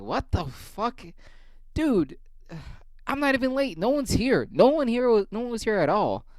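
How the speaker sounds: high incredulous protest; indignant. Retold or imitated. He tells coworker he wasn't late, high indignant disbelief. His own outraged retort retold.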